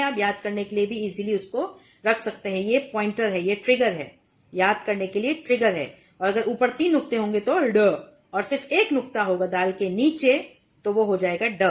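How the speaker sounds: usual speaking voice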